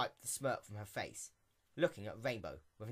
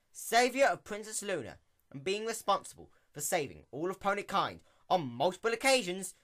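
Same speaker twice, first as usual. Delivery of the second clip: a raised, formal scolding tone; stern mayor; 6 semitones higher, 6 dB louder than usual. Acted. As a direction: lecture her like a strict official. Stern mayor lecturing the princess.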